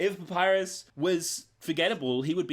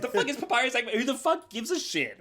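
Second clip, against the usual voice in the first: high-pitched exasperated self-quote; dismissive outburst in a high voice; mock incredulous; 10 semitones higher than usual. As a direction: act out scornful disbelief, pitched way up. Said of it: "I'm like".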